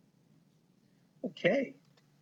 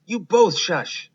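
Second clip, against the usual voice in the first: slower, higher and louder little mouse; bossy little mouse; 7 semitones higher, 7 dB louder than usual. Acted. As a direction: shush the others, firmly and slowly. Mice hushing each other.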